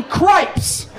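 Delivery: high mock-indignant cripes; hammed-up prudish dad swearing cleanly; exasperated dad. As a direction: spit out cripes like a mild curse. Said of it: "My dad's like".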